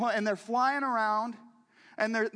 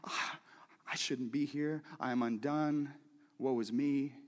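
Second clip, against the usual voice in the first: overwhelmed and undone; 6 semitones lower, 5 dB quieter than usual. Acted. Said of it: "he's saying".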